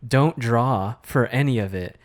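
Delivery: ordinary talk, nothing marked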